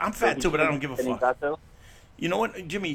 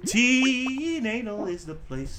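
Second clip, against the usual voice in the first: mock announcer; 6 semitones higher, much slower than usual. Slow jingle-like announcement.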